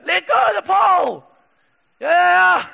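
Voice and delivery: loud, high cheering shout; voicing the crowd shouting encouragement; group yelling up. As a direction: yell up to the boy on the pole. Team shouting up at him.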